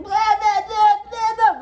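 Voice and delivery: high, loud mock screaming; imitated screaming. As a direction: mimic a man screaming gibberish in her face. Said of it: "he starts screaming".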